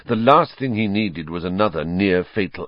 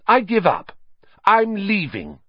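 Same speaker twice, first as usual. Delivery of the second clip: high, louder indignant exit; 13 semitones higher, 4 dB louder than usual. Acted. Hermione leaving the class, high indignant. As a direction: announce it and march out.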